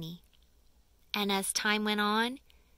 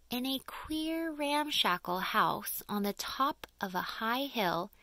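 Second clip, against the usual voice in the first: usual speaking voice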